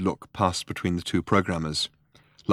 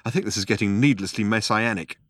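ordinary delivery

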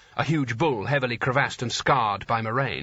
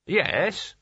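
maniacally glazed; 6 semitones higher than usual. A narrator's reading. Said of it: "maniacally glazed tones".

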